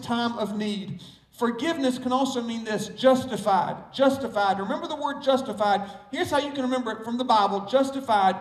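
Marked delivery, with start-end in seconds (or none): none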